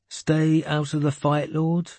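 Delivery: high-voiced boy querying an order; questioning interruption. A narrator's reading. Boy interrupting his lord about the order, questioning. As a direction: cut in with a high, puzzled question. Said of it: "he interrupted me".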